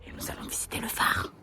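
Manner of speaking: whispered, voice unvoiced; whispering. Real, not acted. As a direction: whisper so others won't hear. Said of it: "parce qu'il y a des gens". (en chuchotant)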